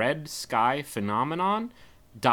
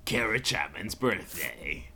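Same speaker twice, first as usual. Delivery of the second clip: slow booming announcer hype; monster truck ad; 3 semitones higher, 9 dB louder, slower than usual. Pretend. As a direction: boom it out like a rally ad.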